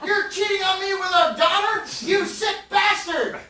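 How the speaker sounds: high, loud, slow furious wife; jealous wife yelling. Acted. The suspicious wife yells at her husband in the kitchen, high and loud. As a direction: hurl it at the cheating husband.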